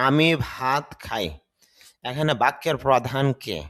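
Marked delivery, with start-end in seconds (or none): 0.1-1.3 slow, clear example read out; slow model sentence. Shown as a demonstration. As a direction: spell the sentence out slowly for learners. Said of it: "এখানে খেয়াল করেন". (ধীরে, স্পষ্ট করে উদাহরণ)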